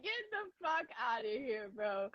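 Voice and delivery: soft laughing retold dismissal; softly giggled mock dismissal; laughing retort. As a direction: say it through dying laughter, quietly. Laughing while retelling own reply.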